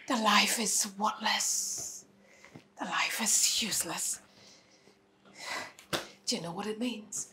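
despairing whisper. Acted. Grieving mother despairs to the officers in a whisper. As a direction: let the words die away in grief.

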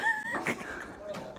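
shrill burst of giggling; high squeal of laughter. Real, not acted. Friend reacts to the grade.

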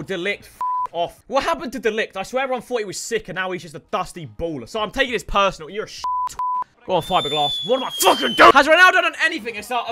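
normal talking voice

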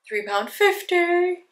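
three pound fifty lifted high and slow; price voice up. Real, not acted. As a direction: let the price float up high. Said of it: "my voice goes up when I say the price".

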